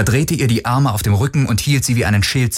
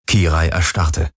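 usual speaking voice

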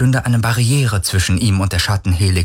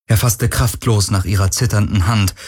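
plain reading voice

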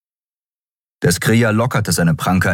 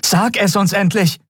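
hohes, verzweifeltes Drängen. A narrator's reading. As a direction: fordere es verzweifelt ein. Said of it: "Verzweiflung ihrer eigenen Stimme". (verzweifelt gedrängt)

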